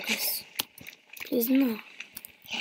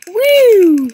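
cheer; 8 semitones higher, 16 dB louder than usual. Real, not acted. Cheering a win.

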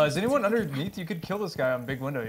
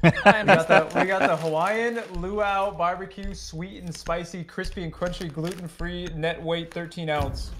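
loud, laughing mock ad read; 4 semitones higher, 3 dB louder, slower than usual. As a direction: list the flavours like a hammed-up commercial. Reciting the chip bag's label.

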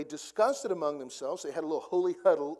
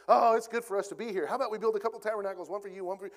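rushed, higher, tumbling words; talking really fast; 5 semitones higher, 5 dB louder, much faster than usual. Retold or imitated. Peter babbling to Jesus on the mountain, fast and rushed. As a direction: gabble it like a panicked Peter. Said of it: "picture him just talking really fast".

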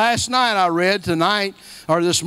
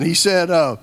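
ordinary talk

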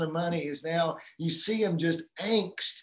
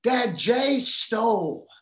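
higher, louder and slow, a small daughter telling on her brother; little girl tattling; 6 semitones higher, 6 dB louder, much slower than usual. Retold or imitated. Daughter greets dad at the door with news, higher, louder, slow tattle. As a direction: drag it out like a kid reporting a crime. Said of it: "she says".

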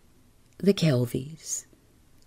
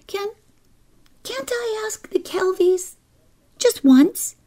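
put-on high, wheedling little girl; pleading child; 3 dB louder than usual. A narrator's reading. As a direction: beg mother in a high child's voice. Child Kezia pleads with her mother.

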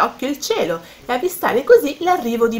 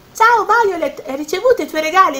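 put-on high, louder, quick girlish question; eager greeting; 8 semitones higher, 4 dB louder than usual. Luce meets friend in park and asks, eager. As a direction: burst with curiosity.

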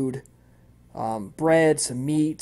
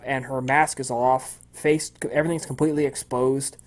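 ordinary talk, nothing marked

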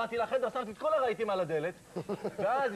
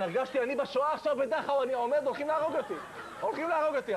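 higher, fast panicked rush; hammed-up terror of being killed; mock panic; 6 semitones higher than usual. Comic recalling panic among Germans, in mock fear.

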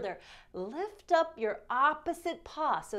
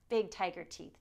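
ordinary talk

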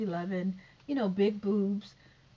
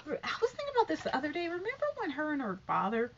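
ordinary talk

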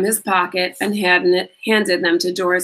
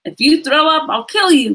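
higher, louder teasing threat; mock threat; 8 semitones higher, 5 dB louder than usual. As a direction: threaten him, half joking. Josh's mock threat to Dink.

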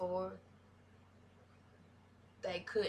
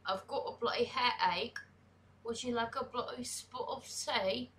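higher-pitched put-on British accent; 5 semitones higher than usual. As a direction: playfully imitate a Brit. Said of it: "I try to talk like them".

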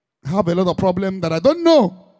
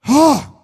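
dismayed exclamation; 5 semitones higher, 6 dB louder than usual. Real, not acted.

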